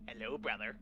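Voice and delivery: a hushed mock-sinister hello; menacing greeting. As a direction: greet him like a looming dark threat. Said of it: "his fucking angry Batman brother going".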